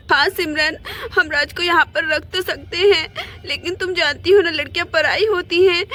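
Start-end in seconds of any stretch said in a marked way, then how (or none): none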